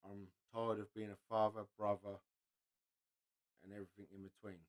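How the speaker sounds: weary reading. Retold or imitated. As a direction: let each line sink with exhaustion. Reading out a sad meme.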